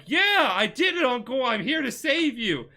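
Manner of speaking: high, excited rescuer's announcement; acted triumphant hero. Nephew greeting the uncle he rescued, acted triumph. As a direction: proudly announce the rescue, playfully hammed-up.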